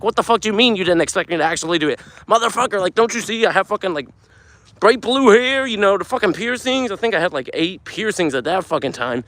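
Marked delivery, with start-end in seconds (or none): 0.0-6.9 high, fast indignant rant; angry. Retold or imitated. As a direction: fire it off in disbelief.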